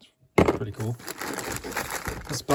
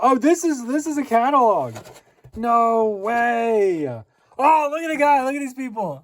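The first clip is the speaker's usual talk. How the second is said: higher, louder thrilled exclaiming; real delight; excited; 8 semitones higher, 5 dB louder than usual. Real, not acted. Flipping an old catalog.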